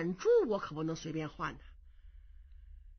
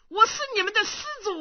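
a lightly voiced old man, higher and faster; boastful old monk; 11 semitones higher, 6 dB louder, much faster than usual. Impostor Kong Xiu boasting to the young monks, smug old man. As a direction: crow it out like a smug elder.

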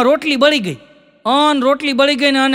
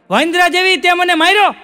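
higher, louder indignant cry of a man just slapped; shocked outrage; 6 semitones higher, 3 dB louder than usual. Retold or imitated. Slapped husband cries out. (થપ્પડ ખાધેલો પતિ, આઘાતથી ઊંચા અવાજે)

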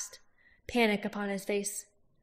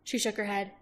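ordinary delivery

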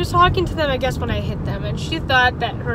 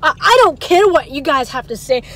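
louder mocking of a furious mother; angry mother; 5 semitones higher, 6 dB louder than usual. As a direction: throw it back as the mother's angry refusal. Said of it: "She was like".

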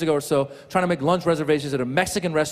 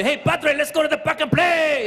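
put-on high-pitched kid with Spanish accent; mock Spanish-accented child calling out; 10 semitones higher than usual. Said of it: "pick up her accent".

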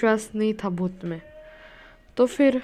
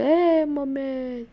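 long drawn-out, higher-pitched cry for mummy; imitated yell, stretched right out; mock scream; 4 semitones higher, much slower than usual. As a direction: act out a scared friend's wail without real volume. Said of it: "तो वो चिल्लाने लगा".

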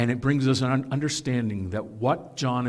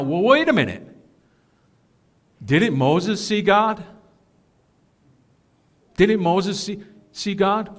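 voiced objection; 6 semitones higher, 3 dB louder than usual. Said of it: "we may be thinking".

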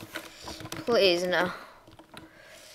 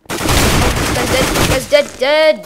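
loud gleeful shout, higher; excited shout; 4 semitones higher, 7 dB louder, much slower than usual. Real, not acted. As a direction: yell it out in triumph. Kill shout in game.

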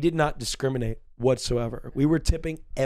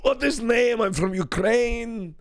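put-on higher, louder, stretched-out Ukrainian accent; 7 semitones higher, 4 dB louder, slower than usual. Imitating the man from Ukraine for his friends, hammed-up Ukrainian accent. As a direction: ham up a Ukrainian accent, drawing the words out. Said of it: "I was trying my best accent".

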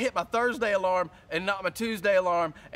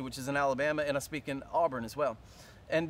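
usual speaking voice

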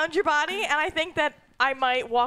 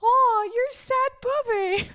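cooing; 8 semitones higher, 3 dB louder, slower than usual. Real, not acted. Player cooing at sad clown.

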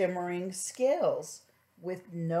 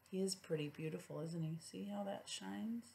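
gentle hushed comment; soft aside; 13 dB quieter than usual. Real, not acted. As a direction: quietly point out the picture. Asides to child over picture book.

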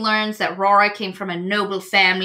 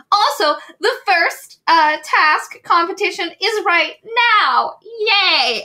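higher and louder, fake-cheery announcer; chipper ringmaster; 11 semitones higher, 4 dB louder, slower than usual. Circus mistress announcing to performers, chirpy hammed-up announcement. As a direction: sell bad news with a bright show voice. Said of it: "she's like".

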